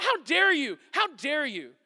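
high, loud mock outrage; defensive. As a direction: act affronted, easing off on the repeat. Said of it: "he didn't get defensive".

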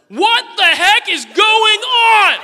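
put-on high, very drawn-out toddler scream; child's yell. Daughter banging on door at final straw, yelling. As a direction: scream every word at full force like a child. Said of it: "and yelled, again, word for word".

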